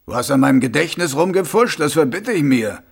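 indignant protest. Acted. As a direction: object forcefully at the affront. Mumm protests at meddled memory.

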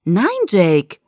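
loud, urgent no; alarmed protest. Acted. Girl tries to stop friend jumping in, alarmed protest. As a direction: cry out to stop him.

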